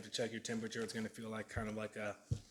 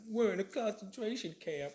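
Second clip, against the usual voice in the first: high mocking dramatic voice; dramatic complainer; 9 semitones higher than usual. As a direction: whine it like a drama queen.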